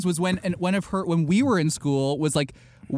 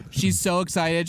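ordinary talk, nothing marked

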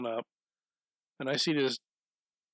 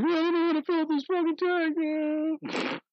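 high, mocking ditzy-girl voice; mock a flustered driver stuck at the pump; ditzy helpless woman; 3 dB louder than usual. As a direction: play her as a clueless girl, pitched way up.

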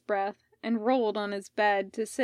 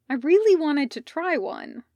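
higher, louder complaint; complaining; 5 semitones higher, 6 dB louder than usual. A narrator's reading. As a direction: protest with mild disappointment, pitched up. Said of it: "Medrin complained".

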